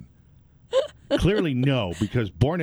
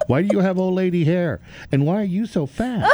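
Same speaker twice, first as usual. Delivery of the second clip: higher, louder mock child voice; six-year-old; 8 semitones higher, 5 dB louder than usual. Six-year-old weight shaming a woman, mock child voice. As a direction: play a blunt kid, pitched up and amused. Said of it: "that six-year-old would have weight shamed me".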